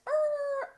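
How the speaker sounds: high, drawn-out "errr" skid; imitated screeching brakes. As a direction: mimic a dog screeching to a halt. Imitates dog braking to turn.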